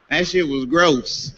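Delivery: ordinary talk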